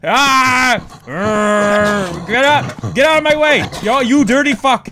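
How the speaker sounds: shouted, frantic and loud; panicked shouting. Real, not acted. Player fleeing a monster, yelling panicked and loud. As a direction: yell at it to get out of the way.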